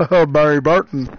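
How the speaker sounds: loud mock cry, pitch unchanged; mock scream. Streamer imitates Barry screaming in the game, loud mock cry. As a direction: belt it out imitating a game character. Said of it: "Barry just screamed".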